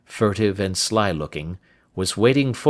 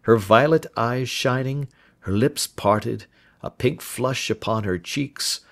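plain reading voice

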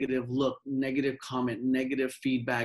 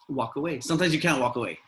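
usual speaking voice

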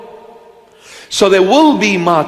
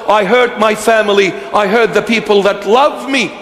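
convert's fervent vow; 7 semitones higher than usual. Acted. Believer vowing to live for Christ, higher and fervent. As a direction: play the newly converted sinner swearing off his old life.

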